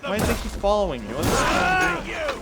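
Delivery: panicked shouts at attackers; panicking gamer. Real, not acted. Attacked in game, yelling.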